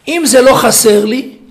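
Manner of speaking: loud, slow, hammering each phrase; full-force preaching. Acted. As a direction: preach it out to the hall.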